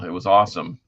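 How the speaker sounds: ordinary talk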